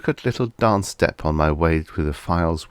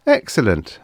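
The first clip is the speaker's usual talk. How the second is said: approving; 6 semitones higher than usual. A narrator's reading. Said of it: "I nodded quick approbation".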